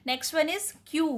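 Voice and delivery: ordinary talk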